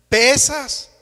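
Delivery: "pesas" hit loud and insistent, a mock outcry; ant exclaiming. Ant insists to the elephant, loud indignant "pesas". Ant repeats complaint louder.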